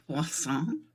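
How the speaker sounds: poisson said softly, French style, pwah-SOHN; enjoy saying poisson quietly; savoured French word. Shown as a demonstration. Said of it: "I would do like to say it".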